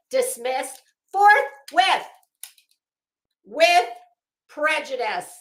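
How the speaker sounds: dismissed forthwith, slow and punched loud; emphatic. Real, not acted. Streamer gloating to chat, slow and loud. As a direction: hammer home each word of the ruling.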